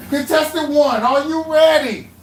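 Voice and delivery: loud, high, slow host call; game show host. Acted. Host calls out to contestant.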